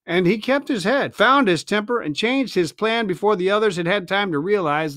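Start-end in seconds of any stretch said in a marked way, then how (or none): none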